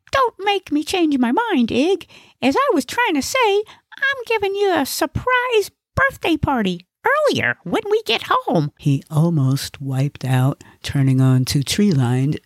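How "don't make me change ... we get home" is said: very high, huffy comic voice; high-pitched, put-upon announcement; huffy Gnesi. Acted. Gnesi announces Ig's surprise party after interruptions, high huffy voice.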